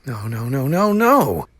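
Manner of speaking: put-on boy's rising panic. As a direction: build to a high wail. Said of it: "thought Tom".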